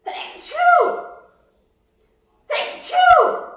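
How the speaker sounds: two loud real sneezes; sneezing. Real, not acted. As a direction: sneeze hard, twice in a row. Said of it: "I'm gonna sneeze".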